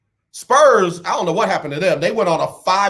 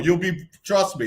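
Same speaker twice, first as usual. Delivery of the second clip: ordinary talk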